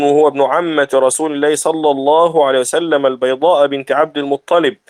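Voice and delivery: usual speaking voice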